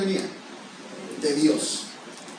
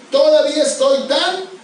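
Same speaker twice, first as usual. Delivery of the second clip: mock bragging, pushed higher and louder; boastful Caleb; 4 semitones higher, 4 dB louder than usual. Preacher mocking boastful Caleb, bragging. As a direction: puff yourself up like a proud old man. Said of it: "mira lo que dice el presumido de Caleb".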